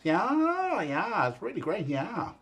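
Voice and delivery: self-mocking attempt at a Midwest accent; terrible Midwest accent. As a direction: ham up a Midwest drawl, knowingly bad. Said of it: "that was my terrible Midwest accent".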